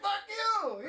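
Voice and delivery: high furious mock yell; angry yell. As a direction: spit it out angrily. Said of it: "He's like".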